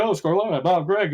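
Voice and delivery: light mock excitement at the name; excited recognition. People at the courts recognize the family name, mock excited. Everybody greeting him excitedly.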